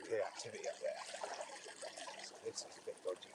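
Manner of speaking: quick whispered aside on the riverbank. Real, not acted. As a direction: keep it under your breath, fish nearby. Hushed while stalking fish.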